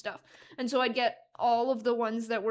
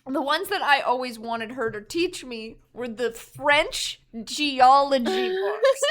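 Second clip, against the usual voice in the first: ordinary delivery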